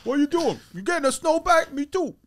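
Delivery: high, goofy comedian voice; Tracy Morgan. Shown as a demonstration. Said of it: "You had a Tracy Morgan".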